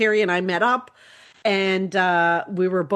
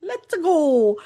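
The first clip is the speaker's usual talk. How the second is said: light Mario voice, higher and louder; a hint of cheery Mario; Mario impression; 8 semitones higher, 3 dB louder than usual. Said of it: "my best Mario impression".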